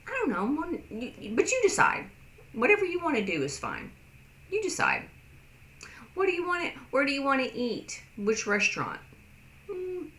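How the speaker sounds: high, light and fast, happy to let you pick; carefree deferring. Retold or imitated. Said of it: "think self is more like".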